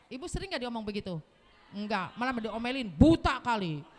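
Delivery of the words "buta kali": loud mock snap; scolding husband. Husband snapping at his fallen wife, loud. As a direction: bark it like an annoyed husband.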